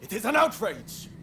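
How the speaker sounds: high indignant outburst; outraged cry. Acted. Samurai rails against a deal made with foreigners, high and outraged. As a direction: spit out your indignation. Ranting against the Red Demon.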